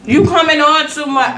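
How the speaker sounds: mock scolding. Real, not acted. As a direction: call her out at the screen.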